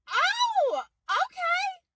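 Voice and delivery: very high, drawn-out acceptance; high-pitched coy delight; pleasantly surprised. Acted. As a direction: squeak it out, suddenly won over.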